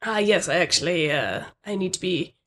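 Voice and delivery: a hint of a reserved manager introducing themselves; manager character. Plays the manager Pat.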